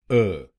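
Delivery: "अ" said as a short neutral "ə"; syllable demo. Shown as a demonstration. Teacher isolating the weak vowel for students. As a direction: give the weak middle "अ" alone. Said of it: "दूसरे शब्दांश को बोलिए".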